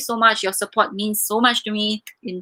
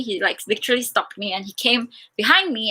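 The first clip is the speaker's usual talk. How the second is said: normal talking voice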